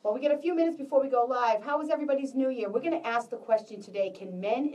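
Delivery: rapid upbeat show-host intro; host hype. Real, not acted. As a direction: pump up the audience quickly. Host revving up before broadcast.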